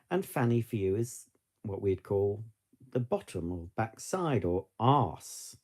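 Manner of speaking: ordinary delivery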